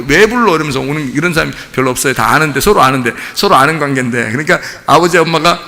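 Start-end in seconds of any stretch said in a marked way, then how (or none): 0.1-0.5 put-on higher-pitched, unashamed child's comeback; mock brazen retort. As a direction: imitate a kid answering back brazenly. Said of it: "막 떳떳하게 막". (떳떳하게 대드는 아이 흉내)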